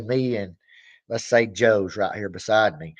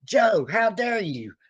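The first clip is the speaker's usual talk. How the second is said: slow, higher, theatrical indignation; drawn-out mock outrage; 8 semitones higher, slower than usual. Fan scolding [name] for not liking [name], slow theatrical indignation. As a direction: stretch each word like a wounded diva. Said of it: "or I say".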